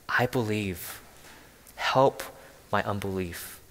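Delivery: a quiet desperate cry, breaking on "Help"; a father's hushed plea for help. Acted. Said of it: "he cries out in full desperation".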